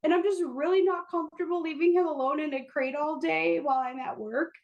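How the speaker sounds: a sheepish excuse broken by laughter; high-pitched giggling excuse to the boss; laughing through an excuse. Retold or imitated. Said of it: "call my boss to tell my boss".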